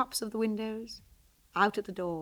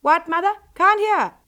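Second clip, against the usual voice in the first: calling out; 10 semitones higher, 9 dB louder than usual. Acted. Calling up the stairs.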